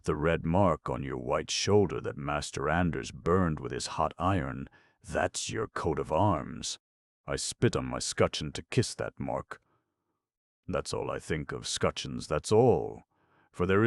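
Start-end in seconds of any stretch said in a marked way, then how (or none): none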